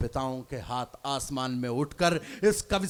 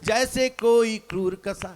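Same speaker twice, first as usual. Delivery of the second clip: stage poet reciting, louder; poem recitation; 6 semitones higher, 10 dB louder, slower than usual. Acted. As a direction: declaim the verse loudly to the crowd.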